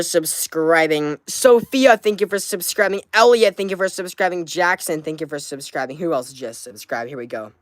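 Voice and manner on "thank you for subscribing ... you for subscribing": ordinary talk, nothing marked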